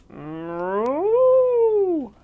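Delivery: long, loud moo swelling up high; big cow moo. A narrator's reading. Storyteller voicing the farmer's big finish, long loud moo. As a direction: swell into a huge drawn-out moo, then fall. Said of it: "let out a big".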